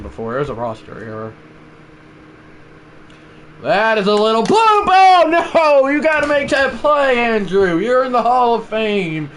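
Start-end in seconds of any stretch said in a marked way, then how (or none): 3.6-9.3 loud, high broadcaster groan of disbelief; announcer dismay. Acted. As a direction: call the blown play like a stunned commentator. Mock commentary on a missed play.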